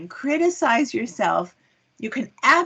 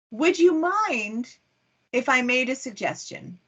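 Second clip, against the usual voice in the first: tactfully sweetened, lingering on "Would you mind"; very nice way; 4 semitones higher than usual. Shown as a demonstration. Someone gently softening criticism for a colleague, very nicely polite. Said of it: "say in a very nice way".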